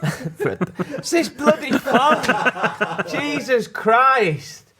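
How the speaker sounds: high, slow, breaking into laughter; giggling through the words; laughing. Real, not acted. Laughing at the bloody phone while reading.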